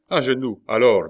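loud, sharp order; commanding. A narrator's reading. Attacker ordering the family to kneel, loud command.

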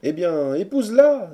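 high, loud retort rising at the end; chorus of friends. A narrator's reading. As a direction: throw the advice back as a crowd. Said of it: "dit le chœur". (réplique du chœur, haute et forte)

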